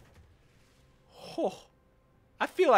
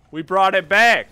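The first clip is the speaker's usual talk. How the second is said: loud comeback cheer; 12 dB louder than usual. Real, not acted. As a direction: celebrate the turnaround.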